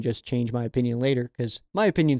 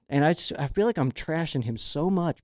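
ordinary delivery

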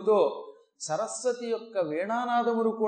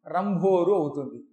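normal talking voice

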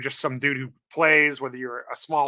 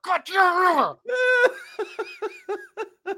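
frustrated shout; much slower than usual. Retold or imitated. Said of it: "I'm like".

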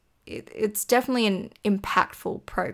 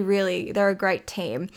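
ordinary talk

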